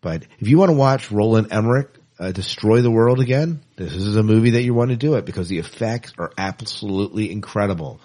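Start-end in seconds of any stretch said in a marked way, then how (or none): none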